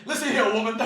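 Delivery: loud menacing boxer taunt; Clubber Lang. Retold or imitated. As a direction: growl it like a trash-talking boxer. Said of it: "Like Clubber Lang from Rocky Three".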